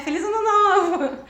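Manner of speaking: loud, high-pitched holiday cheer through a laugh; laughing mock greeting. Retelling New Year wishes to an old flame, laughing cheer. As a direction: call it out big, half laughing.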